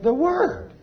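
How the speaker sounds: The Word lifted high as a ringing answer; The Word proclaimed high to the congregation; emphatic answer. Shown as a demonstration. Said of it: "What did He say He sent forth".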